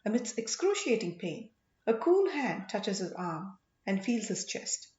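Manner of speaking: ordinary delivery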